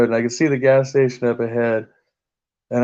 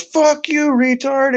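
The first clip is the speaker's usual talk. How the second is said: taunting yell; slower than usual. Retold or imitated. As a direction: fling it out like a parting shot from a car window. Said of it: "and he goes".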